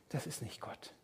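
a hushed, emphatic whisper. Acted. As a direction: breathe it out softly as a firm denial. (eindringlich geflüstert)

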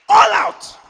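all-out rallying cry; rallying shout. Real, not acted. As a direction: throw it out to the whole hall. Preacher rallying the congregation.

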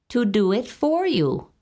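a lightly voiced little goblin; sly hobgoblin. A narrator's reading. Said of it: "said the hobgoblin".

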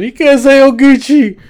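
high, slow, amused correction; laughing rider. Retold or imitated. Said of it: "and he's all laughing".

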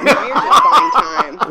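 high squealing laugh through the words; laughing tease. Real, not acted. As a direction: burst out laughing as you tease. Teasing the stalling reader.

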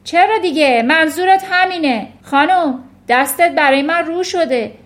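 very loud, high accusing shout; angry shouting. A narrator's reading. Headmistress overruling teacher about the girl, angry shout. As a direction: shout her down forcefully. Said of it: "فریاد کشید".

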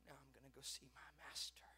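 whispered, low and breath-only; hushed resolve. Retold or imitated. Mary resolving to go to Jesus, hushed whisper. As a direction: whisper it to yourself.

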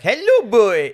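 put-on high, loud German-accented greeting; attempted German accent. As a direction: call it out in a hammy German accent. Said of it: "how do you do a German accent".

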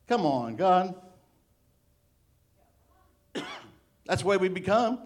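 ordinary talk

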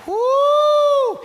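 loud high whoop; excited whoop. Real, not acted. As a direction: let out a thrilled whoop. Excited exclamation in sermon.

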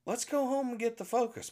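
mother's voice. Retold or imitated. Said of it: "my mother looks at him and says".

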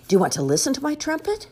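high, fast eager piglet; excited pig voice. Acted. Piggy voice in picture book.

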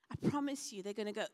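ordinary delivery

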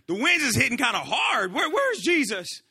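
loud, swooping worried disciple; mock alarm. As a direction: call out as the storm hits. Acting out worried disciples in storm.

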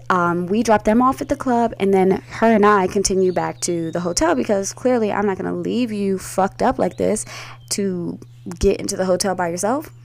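ordinary talk, nothing marked

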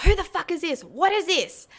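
high-pitched indignant demand; retold outburst, voice shooting up; outraged confrontation. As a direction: confront them with the evidence. Acted confrontation over the photo.

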